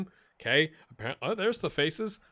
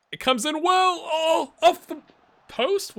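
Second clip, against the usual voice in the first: rising, much higher and louder as the ball nears goal; excited cry; 8 dB louder than usual. Real, not acted. Watching his team hit the post, rising excitement. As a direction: let the hope rise in your voice. Near goal in football game.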